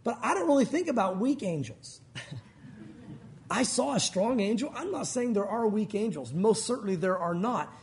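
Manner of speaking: normal talking voice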